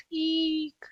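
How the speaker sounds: high frightened squeak; squeaked eek. Acted. Tom stung near the beehive, high squeak. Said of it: "Tom squeaked".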